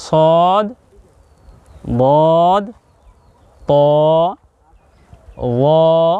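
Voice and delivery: সদ, বদ, ত drawn out long, one by one; teacher slowly modelling সদ to র, each held and separated; letter-name demonstration. Shown as a demonstration. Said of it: "বলুন তো".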